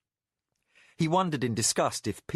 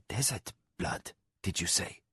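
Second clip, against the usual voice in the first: soft unvoiced aside; whisper; 2 semitones lower, 5 dB quieter than usual. A narrator's reading. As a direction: whisper it under your breath. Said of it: "he murmured sotto voce".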